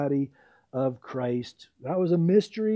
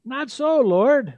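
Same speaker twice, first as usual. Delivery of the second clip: higher, louder startled protest; Peter's loud high objection to the Lord; 8 semitones higher, 8 dB louder, slower than usual. Retold or imitated. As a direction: push back loud and high, shocked. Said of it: "But Peter said".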